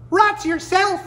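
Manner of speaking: very high tiny hummingbird voice; high-pitched voice. Acted. Hummingbird teases coyote in a tiny high voice. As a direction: squeak it back cheekily. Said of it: "answered a high-pitched voice".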